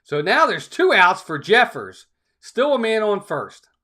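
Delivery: usual speaking voice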